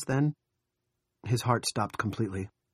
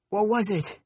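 put-on much higher, small child's question; high childish voice; 13 semitones higher, 4 dB louder than usual. A narrator's reading. Nori asking what crashed, high childish voice. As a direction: pitch it way up like a child. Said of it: "asked in a high, childish voice".

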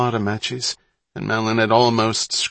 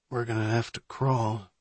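uncertain mutter; 6 dB quieter than usual. A narrator's reading. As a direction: mutter it softly, unsure of the way. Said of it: "Johnny muttered, his voice momentarily uncertain".

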